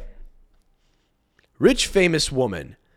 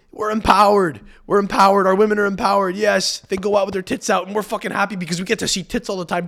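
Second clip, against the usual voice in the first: higher, louder smug bro voice; mock gleeful men; 5 semitones higher, 4 dB louder than usual. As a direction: gloat as the pleased men.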